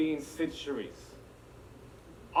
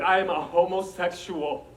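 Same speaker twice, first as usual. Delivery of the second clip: loud, slow, higher-pitched proclamation; retold confession, boldly proclaimed; emphatic declaration; 5 semitones higher, 9 dB louder than usual. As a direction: announce it word by word at high volume. Retells declaring himself to the principal.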